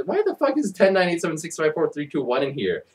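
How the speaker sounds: ordinary talk, nothing marked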